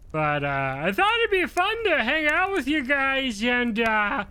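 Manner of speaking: high, airy dim-witted sorcerer voice; aloof dumb sorcerer. Shown as a demonstration. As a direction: play a dangerous but clueless sorcerer. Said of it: "I would love to use this voice".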